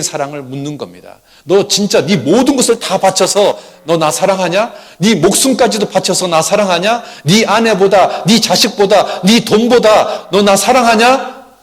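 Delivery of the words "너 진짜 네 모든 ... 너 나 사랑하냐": louder and higher, slowing to the end; the Lord demanding total love, rising to a cry; building impassioned plea. Acted. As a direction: pour everything into the question. Climactic sermon plea as the Lord.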